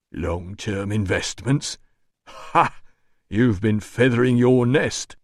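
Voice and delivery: slow, mocking doorman voice; scornful doorman. A narrator's reading. Doorman mocking the administrator's excuse, slow and scornful. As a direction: throw the words back with scorn.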